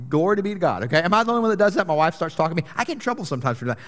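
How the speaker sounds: usual speaking voice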